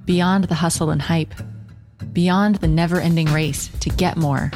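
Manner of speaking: measured, low-key intro narration; podcast intro read. Acted. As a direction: read it like a show opener. Scripted podcast intro over music.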